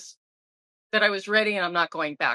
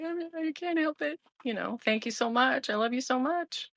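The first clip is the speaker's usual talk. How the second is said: crying; 6 semitones higher, 4 dB quieter than usual. Retold or imitated. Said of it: "I started crying".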